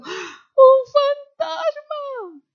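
gasp, then very high drawn-out terrified shriek; hammed-up frightened squeal; cries of fear. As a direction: scream it like you've seen a ghost. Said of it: "Y dieron voces de miedo".

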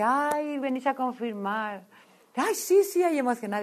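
high, loud, gushing excitement; bursting with joy at the confirmation; delighted squeal. Retold or imitated. Retelling her thrilled reaction to the sisters, squealing with joy.

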